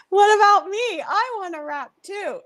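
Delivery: very high, loud pipe-up, easing in volume; high-pitched comic plea; put-on mock voice. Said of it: "in that voice".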